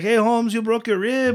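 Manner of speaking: high, loud mock Chicano accent; sing-song Chicano stoner voice; Cheech Marin. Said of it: "talking like Cheech Marin".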